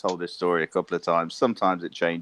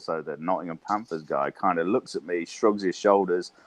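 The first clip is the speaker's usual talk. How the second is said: normal talking voice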